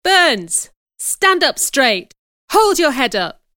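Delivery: loud, high-pitched scolding commands; teacher's shout. Acted. Teacher shouting at a girl in class, loud and sharp. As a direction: shout orders at a pupil, high and forceful. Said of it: "the teacher shouted at the girl".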